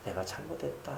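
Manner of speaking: a faint dying father saying sorry; frail father's apology. Retold or imitated. Frail father apologizing to his pastor son, very soft. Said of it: "아버지가 그때 돼가지고 나중에".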